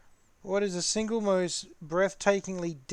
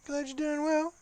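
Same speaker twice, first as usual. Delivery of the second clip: squeaky, much higher mock voice; silly high voice; 10 semitones higher than usual. Teasing his friend Broadsword, in a squeaky voice. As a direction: echo it in a goofy high voice.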